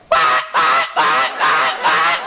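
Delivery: imitated alarm clock honk; repeated harsh "bah" bursts; ugly alarm blare. Comic imitating his four o'clock alarm, ugly blaring. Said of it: "My alarm goes like".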